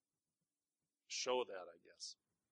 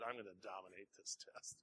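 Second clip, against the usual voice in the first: smack talk; 4 semitones lower, 11 dB quieter than usual. Retold or imitated. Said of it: "we just talk smack all the time".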